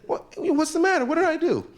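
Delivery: hammed-up squeaky argument; mock pleading. Guy pleads, woman answers.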